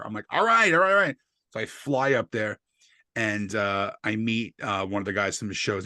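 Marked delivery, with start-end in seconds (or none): 0.3-1.2 frantic agreement. Retold or imitated. Rushing to leave.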